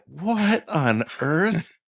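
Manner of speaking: high, slow, disbelieving "what on earth"; baffled wife. Retold or imitated. Husband imitating his puzzled wife mid plot summary, disbelieving. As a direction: mimic the wife's confusion, drawn out high. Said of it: "her brows kind of furrowed".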